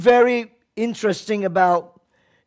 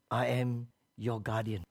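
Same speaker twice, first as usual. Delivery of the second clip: a quiet, low, calm voice from above; hushed divine voice; 7 semitones lower, 11 dB quieter than usual. Acted. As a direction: let the guardian answer softly. God's voice in story.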